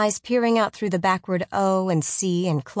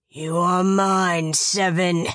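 slow, menacing giant voice; 3 dB louder, much slower than usual. Acted. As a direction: drawl it out like a monster. Giant's voice again.

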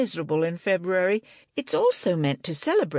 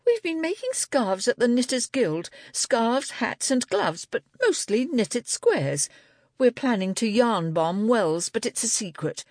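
higher-pitched, defiant explanation; suddenly defiant; 8 semitones higher than usual. A narrator's reading. Angela explaining the knitters' secret plan to Libby, defiant. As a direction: come back at them with sudden defiance, pitched up. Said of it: "suddenly defiant".

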